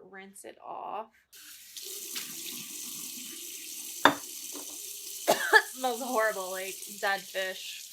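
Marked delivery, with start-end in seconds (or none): 1.1-5.5 dry heaving. Real, not acted. Reacting to the smell. 5.5-7.8 disgusted. Real, not acted. Plant smells of dead fish.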